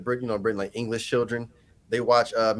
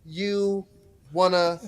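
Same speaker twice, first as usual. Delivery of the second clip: halting delivery, a pause after each word; slow, word by word; 5 semitones higher, much slower than usual. Acted. Asking a child by signs, slow and word by word. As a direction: spell it out deliberately, one word at a time. Spelling out a question slowly.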